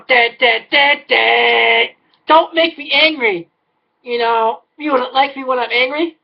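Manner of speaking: understated menacing Hulk quote; mock Hulk. Ranter threatening the cookie aisle, mild mock Hulk menace. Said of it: "I just wanna go".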